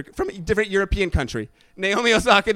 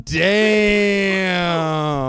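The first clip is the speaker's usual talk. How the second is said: lustful exclamation; 5 semitones higher, 11 dB louder, much slower than usual. Real, not acted. To his cohost about the woman, a loud admiring catcall. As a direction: savor it like a catcall.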